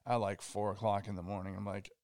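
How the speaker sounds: normal talking voice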